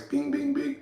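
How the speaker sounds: high-pitched "bing, bing" sound effect; headlight bing. Retold or imitated. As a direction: mimic blinking headlights high up. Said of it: "With the headlights".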